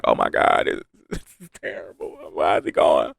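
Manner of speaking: soft, low, half-whispered disbelief; hushed dismay. Retold or imitated. Viewers reacting to the death, hushed and low. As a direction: murmur it as if devastated. Imitating devastated viewers of the episode.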